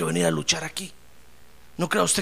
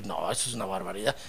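very low, quiet grumble; grumbling disapproval; 11 semitones lower, 9 dB quieter than usual. Acted. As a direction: act out a low, muted complaint. Elí se queja de Ana. (refunfuño grave y bajito)

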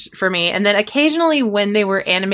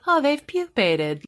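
high, slow, vacant mock reaction; dreamy, unbothered delivery; mock sedated monotone; 6 semitones higher, 4 dB quieter, slower than usual. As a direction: imitate a sedated actor barely reacting. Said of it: "speaking like they've been sedated".

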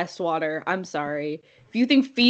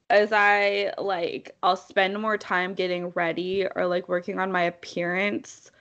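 ordinary talk

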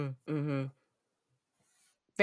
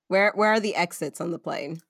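ordinary talk, nothing marked

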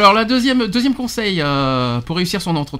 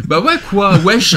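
mock youngster, louder and laughing; youth slang; 2 semitones higher, 6 dB louder, slower than usual. Host mimics how young candidates talk, laughing youth slang. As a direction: toss out wesh like a street kid. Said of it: "Ça, c'est les jeunes".

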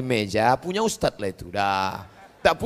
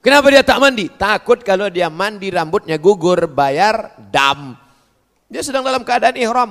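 normal talking voice

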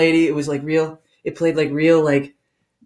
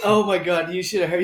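ordinary talk, nothing marked

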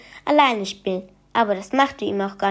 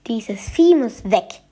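ordinary delivery

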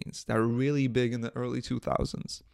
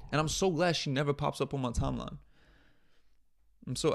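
ordinary talk

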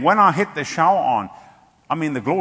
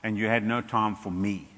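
slow, lower, soft reproach; gentle voice of God; 4 semitones lower, 5 dB quieter than usual. Retold or imitated. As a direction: speak softly like a loving God. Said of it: "he said".

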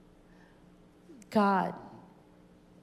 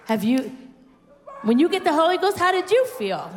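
high, loud call to the congregation; rousing preacher; 9 semitones higher, 5 dB louder than usual. Real, not acted. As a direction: fire the question at the crowd.

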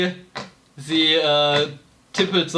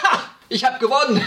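triumphant shout; 8 semitones higher than usual. Acted. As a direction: shout it like you just won. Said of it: "und schreit".

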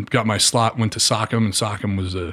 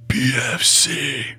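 slow, drawn-out, menacing call of a name; a predator calling your name from the dark; eerie drill sergeant voice; 3 semitones higher, much slower than usual. Retold or imitated. Soldier retelling how a drill sergeant called his name slowly and menacingly. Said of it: "the voice, the voice was just".

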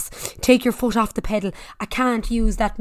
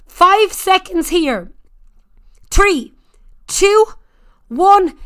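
loud, high hype coach shouting; gym bro; 4 semitones higher, 6 dB louder than usual. Real, not acted. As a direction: yell them through the sprint. Spin coach pushing sprint.

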